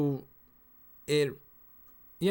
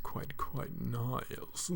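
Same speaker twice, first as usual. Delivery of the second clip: slow, breathy creepy whisper; 8 dB quieter, much slower than usual. Shown as a demonstration. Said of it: "that was very creepy".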